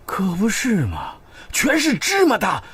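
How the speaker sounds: slow, drawn-out, climbing high; exaggerated agreement. Husband humours complaining wife, slow exaggerated agreement. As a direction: stretch each word in overdone agreement.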